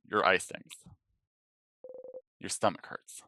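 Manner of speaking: usual speaking voice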